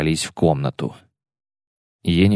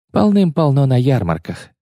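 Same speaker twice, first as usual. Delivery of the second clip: sneering fake sweet; 6 semitones higher than usual. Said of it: "фыркнула она, снова изображая безразличие".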